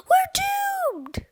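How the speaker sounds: dramatic wail of doom; storybook shout of despair; panicked shout. A narrator's reading. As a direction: cry out as if all is lost. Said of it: "shouted Cheeseball".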